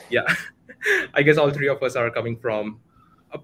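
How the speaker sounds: ordinary talk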